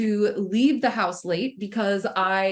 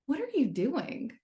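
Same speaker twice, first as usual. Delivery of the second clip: hushed, higher-pitched wondering; softly curious self-question; 5 semitones higher, 6 dB quieter than usual. Retold or imitated. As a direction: ask it gently, observing yourself. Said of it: "I asked it just like that".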